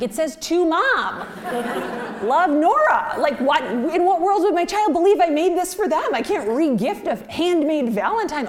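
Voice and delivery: a daughter protesting, high and laughing; high outraged disbelief. Retold or imitated. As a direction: squeal in mock outrage over the valentine. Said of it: "I'm like".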